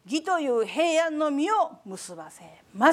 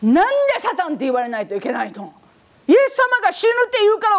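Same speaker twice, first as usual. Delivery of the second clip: quick, huffy complaining in a higher voice; mock indignation; 6 semitones higher, faster than usual. A believer offended at being called Satan, huffy mock anger. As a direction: act out an offended grumbler. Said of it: "もう一種類は怒る人。".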